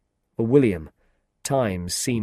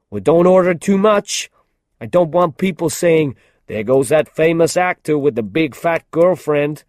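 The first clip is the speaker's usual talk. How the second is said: loud, higher, careless teasing; mocking film star; 7 semitones higher, 8 dB louder than usual. A narrator's reading. Jeff teasing Anna in front of William, loud careless mockery. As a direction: joke at her expense without caring.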